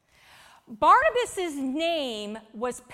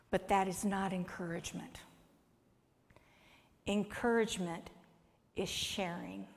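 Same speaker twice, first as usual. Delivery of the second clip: whisper; 4 semitones lower, 8 dB quieter than usual. Real, not acted. Soft aside whisper.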